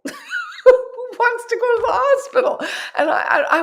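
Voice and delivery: very high, loud, through laughter; squealed amused protest; laughing disbelief. Retold or imitated. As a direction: scoff it out while laughing. Laughing at her own retold reply.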